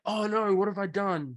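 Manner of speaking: high-pitched imitation of dismay; put-on panic at one's own blunder; mock horror. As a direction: play the horrified culprit, hammed-up and high. Imitates boss realising her blunder.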